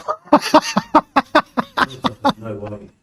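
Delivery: laughing through the words. Real, not acted. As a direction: crack up while speaking. Laughing while retelling.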